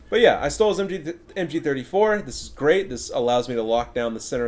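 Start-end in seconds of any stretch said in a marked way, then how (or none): none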